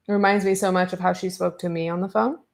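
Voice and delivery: normal talking voice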